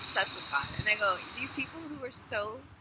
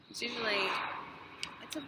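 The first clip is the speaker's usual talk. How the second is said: ordinary talk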